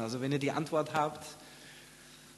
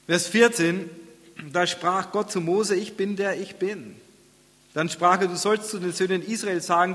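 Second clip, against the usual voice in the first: raised, declaiming pulpit voice; scripture reading aloud; 4 semitones higher, 3 dB louder than usual. Real, not acted. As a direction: read it out high and strong, easing toward the close.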